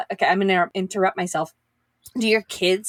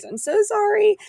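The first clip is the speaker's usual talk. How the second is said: apologetic; 12 semitones higher than usual. Real, not acted. Apologizing for no episode ready.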